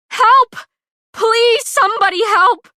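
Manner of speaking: very high, loud scream for help. A narrator's reading. Pei screams to the cheering crowd for help. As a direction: shout desperately to the crowd.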